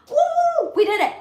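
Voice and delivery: very high joyful cheer; celebratory whoop. Real, not acted. Host celebrating record with viewers, whooping. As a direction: whoop like you won. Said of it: "Twerk session".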